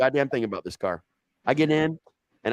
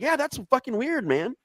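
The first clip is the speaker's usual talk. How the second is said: mock stoned agreement. Retelling his stoned reply about the cops, high. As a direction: agree like you're clueless.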